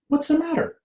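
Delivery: chirpy high little bird. Acted. Bird flying over to sad Cat, high chirpy. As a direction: ask it up high like a small bird.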